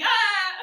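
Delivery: loud high "ah"; imitated wide-open "aah", loud; mimed open-mouth noise. Showing her sister the banana trick, loud "ah".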